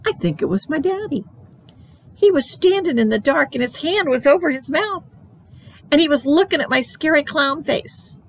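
high, tense storytelling; alarmed child narration. A narrator's reading. Child finding someone by her bed, high alarmed telling. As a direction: build suspense with pitch held up.

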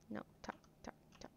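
breathy whisper under the breath. Real, not acted. Whispering to herself while the cats talk. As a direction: hush it so nobody hears. Hushed aside while playing.

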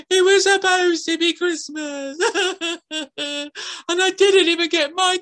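put-on high girl voice breaking into laughter; laughing through a fake lament; mock lament. A narrator's reading. Girl's lament.

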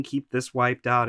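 ordinary talk

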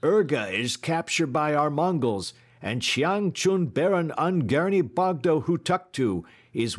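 high, loud rallying cry. A narrator's reading. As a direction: call it out high to rouse a crowd. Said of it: "he cried out".

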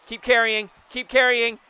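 high, loud, building hope; excited urging. Real, not acted. Urging the hit ball onward.

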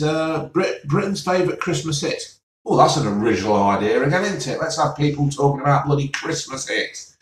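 normal talking voice

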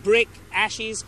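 normal talking voice

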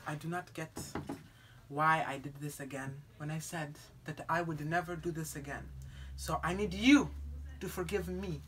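low, hushed, remorseful confession; quiet, contrite apology; ashamed apology. Acted. Acted apology to cousin.

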